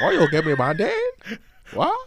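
high, loud mock Chinese accent; exaggerated Chinese-accent imitation, rising in disbelief. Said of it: "talk like a Chinese".

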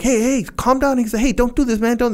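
high, urgent hey calm down; calming yell. Retold or imitated. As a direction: call out to stop a fight. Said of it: "Tony's like".

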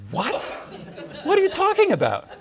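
high, laughing disbelief; can't believe what she just said; baffled laugh. Retold or imitated. Son hearing mom's birthday excuse, laughing in disbelief.

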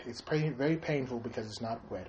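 low, very soft celebrity impression; mock confession, quietly in character; Bill Cosby.

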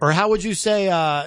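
ordinary talk, nothing marked

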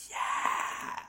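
whispered, voiceless mock cheer; silent cheer. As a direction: cheer without making a sound. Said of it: "everyone was silent, just going".

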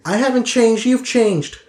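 high, loud, defensive retort; passive-aggressive Trixie. Acted. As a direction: throw the accusation back in a high-pitched voice.

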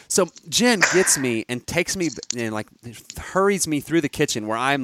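ordinary talk, nothing marked

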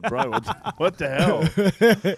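retelling the shock at the grim pub, laughing; laughing disbelief. Retold or imitated. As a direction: crack up while asking what the hell. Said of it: "and I was just like".

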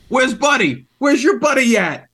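high, loud New York shout; New York accent. Acted. Digger calling for a buddy, shouted in a New York accent. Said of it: "like, New York accent for a minute".

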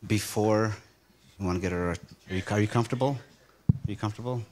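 normal talking voice

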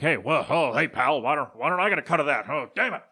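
put-on blustering wrestling boss voice; Vince McMahon. Wrestling boss demanding his cut from talent, hammed-up indignant impression. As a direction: caricature of an indignant company boss. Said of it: "if that was a good Vince McMahon impression".

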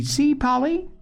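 put-on high-pitched excited child; excited cry. A narrator's reading. Davy showing Polly who has come, excited cry. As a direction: call eagerly, voice way up. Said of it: "cried Davy".

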